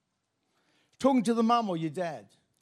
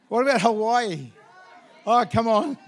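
louder, laughing, teasing; playful tease; 4 semitones higher, 6 dB louder than usual. Pretend. Preacher teasing the congregation about Hawaii, laughing. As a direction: tease the crowd about paradise. Joking about dream destinations.

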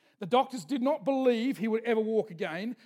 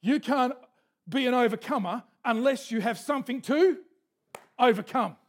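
louder, building emphatic preaching; preached with force; 3 semitones higher, 6 dB louder than usual. Shown as a demonstration. Preacher repeating the line louder to rouse the congregation. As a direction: drive it home to the congregation. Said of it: "Come on".

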